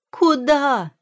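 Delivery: high, loud alarmed shout; crying out. A narrator's reading. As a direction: call out urgently to stop the maid. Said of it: "закричала Юэ". (громкий высокий крик)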